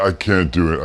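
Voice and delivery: ordinary delivery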